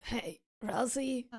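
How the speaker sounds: soft, low barely-there voices; hushed low exchange. Acted. Streamer voicing two shy characters, soft and low. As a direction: murmur it almost inaudibly.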